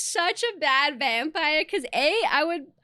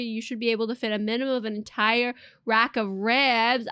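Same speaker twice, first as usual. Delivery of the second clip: ordinary delivery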